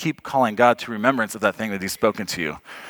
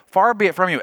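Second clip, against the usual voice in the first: lekko podniesiony głos; raised voice; 6 semitones higher than usual. Shown as a demonstration. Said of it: "raising his voice and yelling at God".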